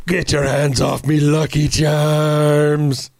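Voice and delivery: hammy Irish brogue, last words drawn out; Liam Neeson. Acted. Clowning for co-hosts as an Irish actor, mock Irish accent. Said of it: "that's my Liam Neeson impression".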